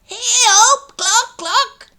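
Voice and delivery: very high, loud squawking hen; clucking hen. Acted. Hen calling to Jack for help, high clucking squawk. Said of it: "she clucked".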